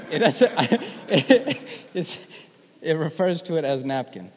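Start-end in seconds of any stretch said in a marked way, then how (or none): 0.1-1.9 high, loud burst of laughter; real laughter. Real, not acted. Preacher laughing at the King James phrase, real laughter. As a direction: crack up at the odd wording.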